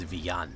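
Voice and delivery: Villan said the Spanish way, vee-YAHN; Spanish pronunciation. Shown as a demonstration. Streamer showing the Spanish pronunciation of his surname, Villan as vee-YAHN. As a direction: say Villan with the double L as a Y. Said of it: "the Spanish pronunciation of my name".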